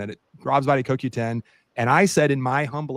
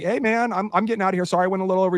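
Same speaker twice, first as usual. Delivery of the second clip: much higher, sheepish sorry-I'm-leaving; mock apologetic; 7 semitones higher, faster than usual. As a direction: play it up as a meek apology. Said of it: "And I'm like".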